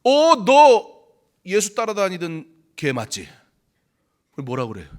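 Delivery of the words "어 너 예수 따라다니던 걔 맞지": high, loud, accusing cry; accusing bystander. Acted. A bystander calls out to Peter, loud and accusing. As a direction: point the finger and shout it out.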